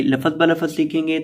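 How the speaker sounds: normal talking voice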